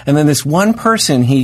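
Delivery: ordinary delivery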